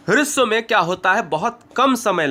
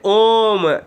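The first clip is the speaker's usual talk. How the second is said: drawn-out om. Shown as a demonstration. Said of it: "जैसे".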